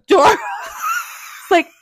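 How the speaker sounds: very high, slow laughing shout; laughing shriek. Real, not acted. Telling how Kalani threw the door open, laughing shriek. Climax of the story.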